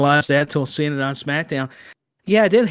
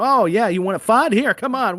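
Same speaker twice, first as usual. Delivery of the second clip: mock picking a fight; 7 semitones higher than usual. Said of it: "they'll go".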